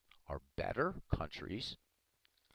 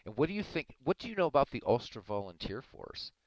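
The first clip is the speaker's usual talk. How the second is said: heated; 3 semitones higher, 7 dB louder than usual. Real, not acted. Heated argument with a chatter about Ireland.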